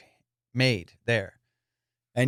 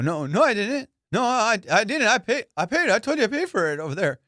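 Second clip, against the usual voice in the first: high, loud, flustered protest; defensive and caught out, pitch jumping up; guilty denial; 10 semitones higher, 4 dB louder than usual. Retold or imitated. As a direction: deny it too hard, stumbling over your words. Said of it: "my voice, guilty".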